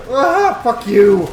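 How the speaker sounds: high, loud yelp into a curse; angry outburst. Real, not acted. Gamer cursing at teleporting enemies, angry outburst. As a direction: lash out loudly at the enemy.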